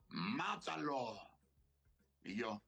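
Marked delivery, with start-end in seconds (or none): 0.1-1.2 slow, cold gunman's command; drawn out like a death sentence; lightly voiced gunman ordering the kill; robber's order. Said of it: "le dice el que me tiene apuntando".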